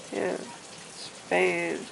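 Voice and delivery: normal talking voice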